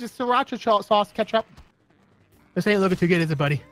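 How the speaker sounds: ordinary talk, nothing marked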